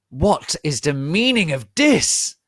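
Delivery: loud, high indignant outburst; angry demand. Acted. Dumbledore bursting in on the fight, loud angry demand. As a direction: storm in and demand answers. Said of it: "all angrily".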